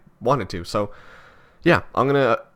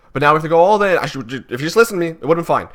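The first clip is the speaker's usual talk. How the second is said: loud, higher, blaming rant; retold angry scolding; 7 semitones higher, 7 dB louder than usual. Son angrily blaming his mom in the car, loud and raised. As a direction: scold her for not listening.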